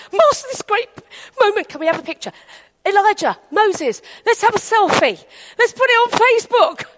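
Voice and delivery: high, gushing eager disciple; excited blurting. Acted. Peter asking Master for a selfie, excited. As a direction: gush like a star-struck fan. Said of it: "He'd have said something like".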